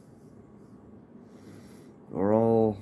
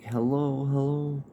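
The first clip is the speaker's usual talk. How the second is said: higher, louder repeated hello into the line; calling into phone; 8 semitones higher, 4 dB louder than usual. Retold or imitated. Teller shouting into a dropped phone, higher and louder calling. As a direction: call out hello, trying to get her back.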